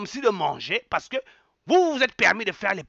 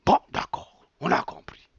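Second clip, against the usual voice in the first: normal talking voice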